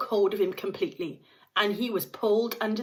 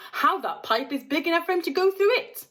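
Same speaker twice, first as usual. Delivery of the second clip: puzzled father; 10 semitones higher, 4 dB louder than usual. A narrator's reading. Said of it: "said Mister Gloop".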